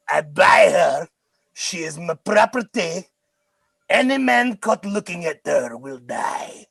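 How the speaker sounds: heavy mock French accent, drawn out and shouted. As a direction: bark it like a pompous Frenchman. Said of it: "in this French accent".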